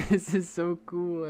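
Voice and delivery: laughing while saying it. Real, not acted. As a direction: laugh through the words. Repeat with a laugh.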